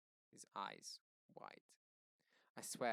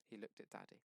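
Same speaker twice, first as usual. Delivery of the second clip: ordinary delivery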